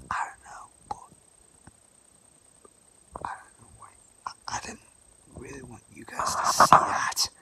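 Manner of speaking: hushed secret whisper. Real, not acted. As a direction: whisper so mum can't hear. Whispering so mum won't hear.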